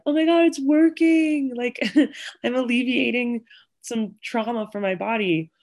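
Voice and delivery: retelling a high, giddy rush with a laugh; overjoyed gasp. Retold or imitated. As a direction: squeal it as the relief hits. Retold sudden emotional breakthrough in car.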